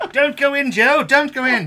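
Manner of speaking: loud mock horror-movie warning; mock warning shout. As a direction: yell a warning at the screen. Warning Joe in a horror scene.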